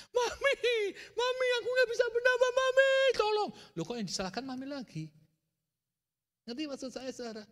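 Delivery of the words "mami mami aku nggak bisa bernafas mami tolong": very high, loud desperate pleading; child's panicked cry. Retold or imitated. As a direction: scream for mum, unable to breathe. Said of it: "teriak-teriak". (anak menjerit panik memanggil maminya)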